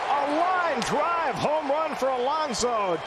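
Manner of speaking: normal talking voice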